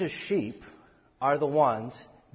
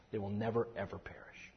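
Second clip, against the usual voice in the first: hushed emphasis; 4 semitones lower, 11 dB quieter than usual. Shown as a demonstration. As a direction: drop to a soft breathy whisper on "never, ever perish". Whispered repeat of promise.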